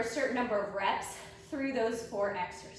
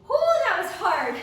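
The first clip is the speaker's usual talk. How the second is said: breathless, much higher and louder; out of breath; 8 semitones higher, 8 dB louder than usual. Real, not acted. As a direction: puff it out after the effort. Just finished hard set.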